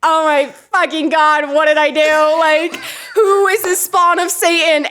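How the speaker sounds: high, slow, stunned disbelief; horrified exclamation. Retold or imitated. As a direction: gasp at the little monster you made. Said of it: "I was like".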